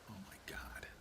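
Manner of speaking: breathy whisper of frustration; whispered exasperation. Real, not acted. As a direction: groan it under your breath. Frustrated at the heart.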